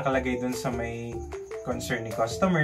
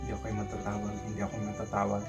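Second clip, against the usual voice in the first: softer, hushed wishful muttering; quiet prayer; 5 semitones lower, 9 dB quieter, much faster than usual. Retold or imitated. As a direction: chant it under your breath. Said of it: "Sabi ko". (pabulong na dasal)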